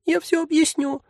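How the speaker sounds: very high, thin pleading voice; a tiny creature begging to be heard; plaintive tiny voice. Acted. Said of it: "жалобный тоненький голосок".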